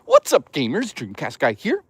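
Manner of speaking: mock YouTuber intro, lifting at the end; Dreamcast guy. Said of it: "Dreamcast guy sounds like this".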